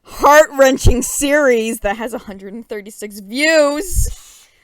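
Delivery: loud, slow mock grandeur; mock hype. Hyping a series with few views, mock grand delivery. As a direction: oversell it sarcastically.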